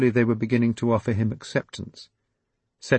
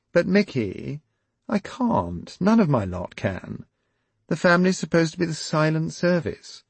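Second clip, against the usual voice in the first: princess demurring; 9 semitones higher, 3 dB louder than usual. Acted. Princess voiced higher.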